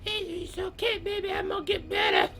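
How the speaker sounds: high, wheedling fake-granny falsetto; feigned sickly Grandma. Wolf as sick Grandma asking for muffins, high falsetto. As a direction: play poorly in a high old-lady voice.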